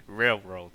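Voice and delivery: "railroad" with muddled r and l sounds; struggled pronunciation. Shown as a demonstration. Streamer showing a word they can't say, struggling. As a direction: trip over the r's in "railroad". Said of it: "struggle with one word I can't say".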